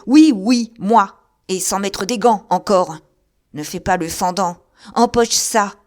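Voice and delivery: loud, building furious roar to a peak; furious threats. Acted. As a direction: drunk brawling with a phantom. Delirious fight with imagined rival.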